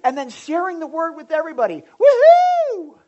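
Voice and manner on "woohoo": very high, loud sustained cheer; rejoicing whoop. Acted. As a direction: let out a jubilant whoop. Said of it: "they started rejoicing".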